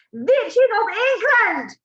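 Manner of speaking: slow, high, loud summons; shouted witch call. A narrator's reading. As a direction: bellow it slowly across the hall. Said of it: "she yelled".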